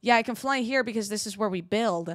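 ordinary talk, nothing marked

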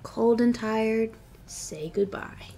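lively picture book read-aloud; reading to children. Acted. Teacher reading a picture book aloud to her class. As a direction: read it like story time for little kids.

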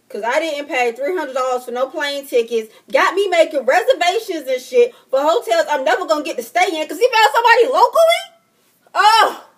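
shouting, climbing into a high screech; furious rant. Real, not acted. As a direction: build from loud outrage to a shrill-pitched yell. Furious rant over wasted plane tickets.

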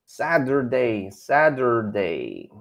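"Saturday" slowly with an American flap, "sa-der-day"; slow American model. Shown as a demonstration. Teacher drilling the class on the word, slow. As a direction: model "Saturday" twice, slow, flapped t and r-coloured middle. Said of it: "Who else?".

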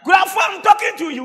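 high, loud, quick scolding call; indignant call. Retold or imitated. Preacher scolds boy who ignores him, indignant call. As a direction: demand his attention, pitched high. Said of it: "I say".

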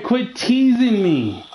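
high, drawn-out whine; exasperated complaint. Real, not acted. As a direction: complain high and slow at being teased. Frustrated complaint at game character.

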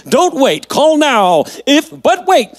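high, pushy TV pitchman voice; infomercial. Pretend. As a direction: sell it like a late night ad. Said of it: "I sound like an infomercial".

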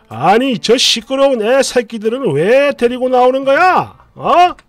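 loud, annoyed outburst that mothers nearby should hear; husband loudly grumbling about noisy kids in a café; loud irritated rant. Retold or imitated. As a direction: snap it loudly so the whole café hears. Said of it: "엄청 크게 짜증을 내고요".

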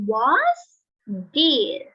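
a teacher's model of "What's this?" with the s made plain; model pronunciation. Shown as a demonstration. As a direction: show the s sound at the end of "What's". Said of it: "s ก็เป็นสอเสือ".

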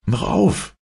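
high-pitched wake-up call; storybook cry to wake mother. A narrator's reading. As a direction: rouse a sleeper with a sharp high call. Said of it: "rief er".

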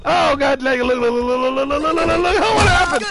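high, frantic babbling yells; panicked. Real, not acted. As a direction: yelp in panic as the killer attacks. Killer breaks through at player.

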